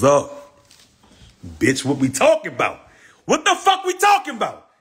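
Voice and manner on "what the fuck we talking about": squeals it way up high; high-pitched outburst. Real, not acted. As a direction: shrieks in disbelief. Outburst at chat.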